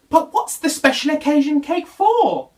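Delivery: cheering up, asking; Little Bear curious. Acted.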